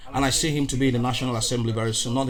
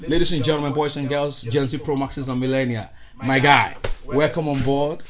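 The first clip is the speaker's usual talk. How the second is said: ordinary talk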